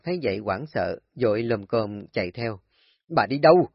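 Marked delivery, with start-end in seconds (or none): 3.1-3.7 calling out. Acted. Husband chasing wife.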